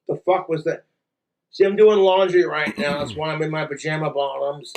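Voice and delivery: usual speaking voice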